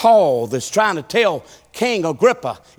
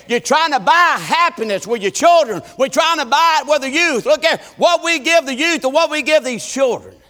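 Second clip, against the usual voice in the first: rising preaching; 4 semitones higher than usual. Acted. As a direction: build the sermon line by line. Preaching, building up.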